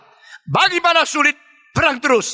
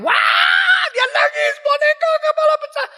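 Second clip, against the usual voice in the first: put-on high wailing child crying over a broken doll; tangisan anak. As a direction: wail like a small child whose doll just broke. Anak menangis boneka pecah. (anak kecil merengek menangis, suara tinggi)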